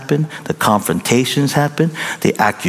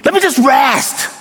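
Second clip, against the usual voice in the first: fed-up outburst. Retold or imitated. Tired husband snaps at his wife, mock shout. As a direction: yell at her to leave you alone. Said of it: "You just go".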